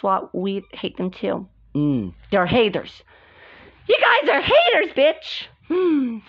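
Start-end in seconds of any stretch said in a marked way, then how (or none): 3.9-6.2 high, raised acted insult; taunting yell. Telling off the hated rivals, high angry yell. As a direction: hurl it at the rivals, pitched right up.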